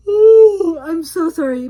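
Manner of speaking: loud yawn into an apology. Real, not acted. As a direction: yawn big, then apologize. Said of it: "My drugs make me do this".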